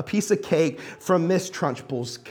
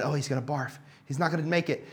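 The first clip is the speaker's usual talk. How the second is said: murmuring; 3 semitones lower, 7 dB quieter than usual. Retold or imitated. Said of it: "the murmurings in the crowd".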